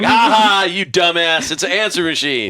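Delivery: loud, high taunting laugh into the words; mocking gotcha. Prank machine message taunting callers, loud gloating mockery. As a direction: crow at the fooled caller.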